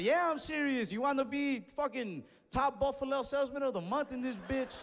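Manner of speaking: high-pitched, mock-salesman hype; hyped-up challenge. Acted hyped-up challenge to the cashier.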